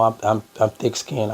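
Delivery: ordinary talk, nothing marked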